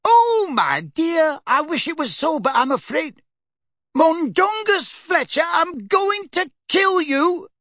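put-on old woman wailing, then vowing revenge; fretful old lady swearing to kill him; despairing old lady. Mrs Figg despairing then threatening.

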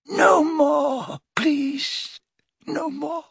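high, pained pleas; anguished pleading. A narrator's reading. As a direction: beg it to end. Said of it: "he yelled again".

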